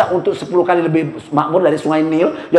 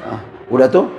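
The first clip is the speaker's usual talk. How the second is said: normal talking voice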